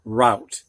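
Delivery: "route" said like rout, rhyming with out; ow pronunciation. Shown as a demonstration. Teacher showing how R O U T is pronounced, "route" rhyming with out. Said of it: "this R O U T is only pronounced".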